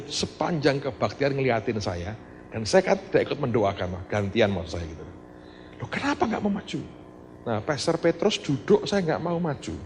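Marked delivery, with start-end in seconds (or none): 5.8-6.8 high, puzzled ask; teacher asking the child, puzzled; surprised question. Retold or imitated. Asking child why not come.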